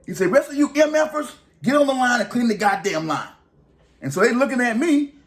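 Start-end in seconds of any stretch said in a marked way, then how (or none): 0.3-3.3 high mock boss ordering the crew; boss barking orders. Supervisor ordering the other inmates back to the line, in a high mock voice. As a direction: snap the crew back to work.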